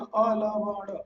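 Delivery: "अलवण" stretched out as "aa-la-va-ṇ"; syllable-by-syllable model. Shown as a demonstration. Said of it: "अलौना नहीं होता".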